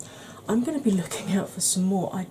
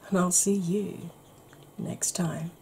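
ASMR whisper; 3 dB quieter, slower than usual. Acted. Whispered sign-off.

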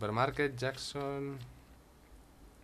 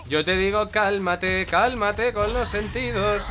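loud, slow, higher mock repeat of the game's calm-down line; 9 semitones higher, 8 dB louder, slower than usual. Mocking the in-game character telling him to calm down, loud slow parody. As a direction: parrot the character's calming words in exaggerated slow mockery. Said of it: "Calma, cálmate".

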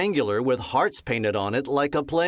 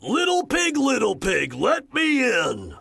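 high, sing-song wolf voice; wolf calling; 10 semitones higher than usual. Acted. Wolf at Penelope's door, high coaxing call. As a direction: coax the pig to open up. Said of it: "said the wolf".

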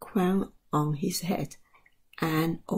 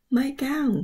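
mild excited yell; 8 semitones higher than usual. A narrator's reading. George spots his old gown in the bag, mild yell. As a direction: read it as a contained, high-pitched yell. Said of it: "he yelled".

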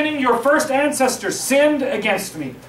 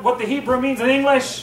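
ordinary talk, nothing marked

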